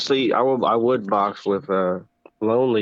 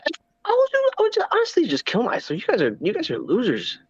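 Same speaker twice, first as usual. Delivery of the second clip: mocking high voice; 9 semitones higher than usual. Mocking impression continues, high pitch.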